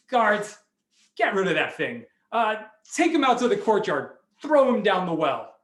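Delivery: loud, irritated king's order; commanding. Acted. King telling guards to dump the rooster, commanding.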